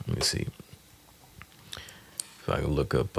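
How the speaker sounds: ordinary delivery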